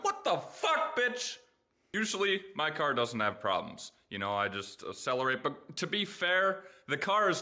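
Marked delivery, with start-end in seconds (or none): 0.0-1.4 high startled curse at the car; mock shock at the car. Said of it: "And I'm like".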